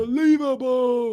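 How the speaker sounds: high, loud, stretched-out sportscaster cry; Dickie V. Acted. Co-host joining the impression of famous calls, high and drawn-out. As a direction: call it slowly in excited commentator style. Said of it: "Zion Williamson calls he'd make".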